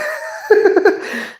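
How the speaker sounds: high-pitched helpless laugh; long, high giggle fit; real laughing fit. Real, not acted. Breaking up mid-joke, high helpless laughter.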